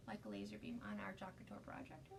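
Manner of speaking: whisper. Real, not acted. Focusing quietly on craft work.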